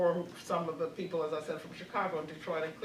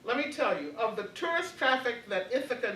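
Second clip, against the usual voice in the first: ordinary delivery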